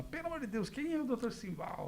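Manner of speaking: a nurse in a fluster, high and quiet; panicked nurse. Retold or imitated. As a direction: play the frightened nurse, high and hushed. Panicked nurse voiced in story.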